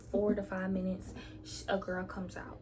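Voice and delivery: normal talking voice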